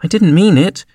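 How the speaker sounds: high, apologetic plea; pleading. A narrator's reading. Alice pleads with offended Mouse, high and pleading. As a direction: plead it, pitch lifted high. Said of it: "pleaded poor Alice".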